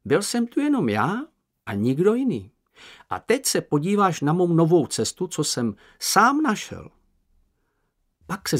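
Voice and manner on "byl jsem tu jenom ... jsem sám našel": high, eager little creature boasting; Sniff's squeaky voice. Acted. Sniff boasting to Moomin, high and proud. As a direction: show off your secret path, pitched up.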